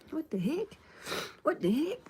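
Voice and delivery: high-pitched rising mock disbelief; mock bafflement. Streamer baffled at getting tired on live, mock disbelief. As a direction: squeal it upward as a playful question.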